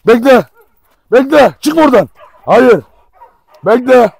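loud, sharp orders; shouted commands. Real, not acted. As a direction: order the dog to stay, loudly. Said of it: "Şimdi niye bağırıyorsun derseniz". (bağırarak emir verme)

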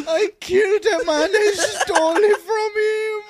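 high, drawn-out mock bawling; crying. Suspect confessing a killing, mock crying. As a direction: wail the confession out for laughs. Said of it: "He just started crying".

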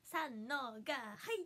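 slow, stretched-out count-in; Kokko's quirky count-in. Retold or imitated. Streamer replaying a friend's funny cue, slow and quirky. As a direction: draw out each beat like a cue. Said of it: "コッコちゃんが急にね".

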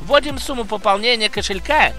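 brisk upbeat sponsor read. Acted. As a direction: sell it like a quick promo. (рекламная подача)

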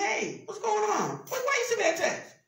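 high-pitched, animated questioning; puzzled friendly challenge. Acted. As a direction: act out phoning a friend for an explanation. Acting a phone call to friend.

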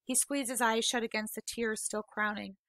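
usual speaking voice

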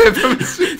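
high burst of laughter; laughing. Real, not acted. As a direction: laugh it out in relief.

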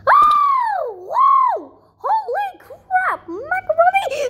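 high, shouted outburst; alarmed exclaiming. Real, not acted. Player nearly falls, alarmed high shouting. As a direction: cry out high in shock.

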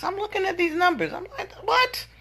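high squeaky what, laughing in disbelief; stunned high-pitched what; disbelieving. Retold or imitated. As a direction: shriek it out in total disbelief. Staring at the numbers in disbelief.

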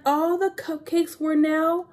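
usual speaking voice

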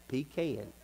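soft, low pecan, pee-KAN; Southern pecan. Shown as a demonstration. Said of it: "not pecan".

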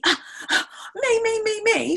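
high, gasping "pick me" plea; high-pitched, impatient begging to be chosen; eager child. Acted. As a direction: act a child bursting to answer. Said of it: "She really wants to say something".

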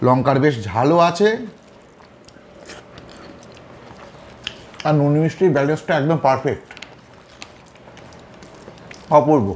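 talking with food in mouth; muffled chewing speech; mouth full chewing. Real, not acted. Seen 0: Lips pursed, cheeks full, chewing while speaking between handfuls of food.

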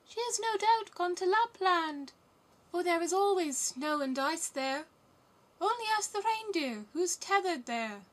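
very high, loud bird voice; high, hooting pigeon voice; wood pigeons. A narrator's reading. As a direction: lilt the answer like a storybook pigeon. Pigeons answer Gerda.

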